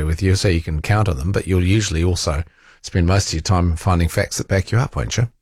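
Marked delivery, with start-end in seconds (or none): none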